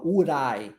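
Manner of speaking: "would I" run together, the D linking into I; slow, join "would I" into one sound; linked would I. Shown as a demonstration. Teacher slowly linking "would I" for the student. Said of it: "Olha a junção do D com I".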